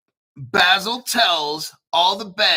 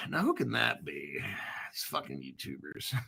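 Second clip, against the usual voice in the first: mocking grumpy critic; 14 semitones lower, 12 dB quieter than usual. Mocking a disapproving viewer.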